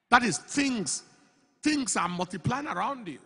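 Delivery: from steady sermon to full-force preaching. Acted. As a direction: preach it harder line by line. Preaching builds.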